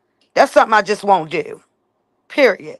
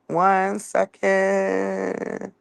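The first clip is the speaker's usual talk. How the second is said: very slow, stretched-out words, softer; drawn-out stalling; 7 dB quieter, much slower than usual. Real, not acted. Streamer stalling viewers for a moment, long drawn-out words. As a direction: hold the last word out long and level.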